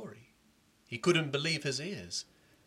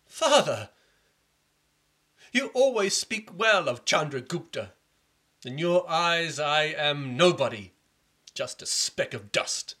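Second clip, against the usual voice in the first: loud, slow self-pity fading to a whisper; bitter son; 4 semitones higher, 7 dB louder than usual. Acted. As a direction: complain then breathe the last words. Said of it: "prince complains to father".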